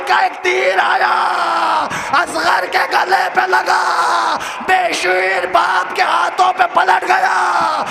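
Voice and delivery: high, shouted lament at full force; a mourning orator's chanted cry; wailing majlis recitation. Acted. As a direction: preach the grief out in a rising wail. Mourning recitation rises to wail.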